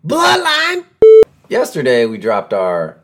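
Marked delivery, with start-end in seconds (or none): 0.1-0.9 mock wrestler catchphrase. Roman Reigns catchphrase shout.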